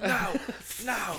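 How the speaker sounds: high, loud panicked plea; woman in labor. Pretend. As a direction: beg like you're mid-contraction. Acting out giving birth.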